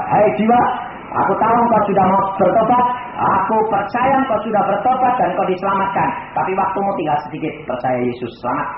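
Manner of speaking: loud fervent preaching; preaching to the dying. Retold or imitated. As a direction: proclaim salvation over him. Pastor preaching to dying man. (berkhotbah dengan lantang)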